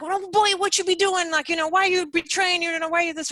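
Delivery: high, loud, accusing voice; angry confrontation. Retold or imitated. Angry man with a knife to a student's throat, loud and accusing. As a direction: imitate an angry man threatening someone. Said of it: "growing angrier and angrier".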